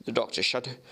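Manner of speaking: ordinary delivery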